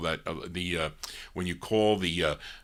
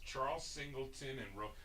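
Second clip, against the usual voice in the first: very soft, higher murmur, breaking off; muttered reading; 4 semitones higher, 17 dB quieter than usual. Real, not acted. Host checking songwriter names, barely audible reading to himself. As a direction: skim the names under the breath. Reads credits to himself first.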